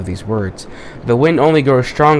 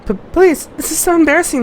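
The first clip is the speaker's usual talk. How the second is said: high falsetto, stammered plea; embarrassed girl falsetto. Acted. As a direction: squeak it out mortified.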